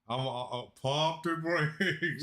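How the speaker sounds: slow, drawn-out PUMP THE BRAKES; drawn-out catchphrase. Real, not acted. Co-host cautioning against excitement over Klay, drawn out slowly. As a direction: stretch out "pump the brakes" at half speed. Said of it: "I'ma say it like this".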